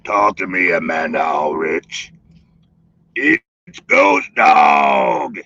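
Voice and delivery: low hammed-up shout to the chat; deep mock call. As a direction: bellow it like a spooky ghost. Calling out in deep voice.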